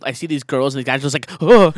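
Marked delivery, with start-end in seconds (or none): 1.4-1.7 loud, higher mock gasp; imitated shocked reaction. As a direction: gasp like a startled bystander. Imitates onlookers reacting to bloody face.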